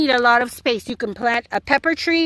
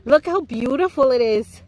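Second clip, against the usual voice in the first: normal talking voice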